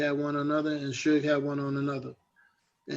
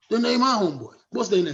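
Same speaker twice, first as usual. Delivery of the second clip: loud, raised, decisive declaration; emphatic welcome; 6 semitones higher, 6 dB louder than usual. Retold or imitated. [name] adopting his friend's homeboys, emphatic welcome. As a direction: imitate an exuberant claim of loyalty.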